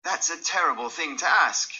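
a shocked friend protesting; exclaiming. Acted. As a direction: recoil and exclaim. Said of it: "he exclaimed".

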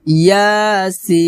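ইয়াসিন chanted slowly, the "সিন" held for four counts; long madd recitation. Shown as a demonstration. Teacher showing four-alif stretch on সিন, slow recitation. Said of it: "সিন এখানে চার আলিফ টান দিব".

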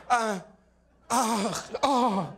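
mock flustered high stammer; stammering president. Comic mocking the president's fumbling stammer. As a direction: hem and haw like a lost old president.

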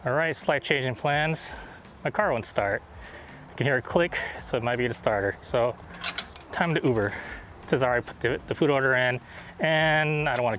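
muffled behind mask; covered mouth speaking; through a face mask. Real, not acted. Seen 1: Blue surgical mask covers nose and mouth in every frame.

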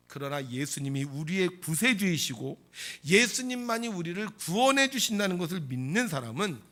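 ordinary talk, nothing marked

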